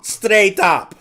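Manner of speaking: slow, hammed-up fake Russian accent; really horrible fake Russian accent; KGB voice. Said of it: "he says it in that K G B voice".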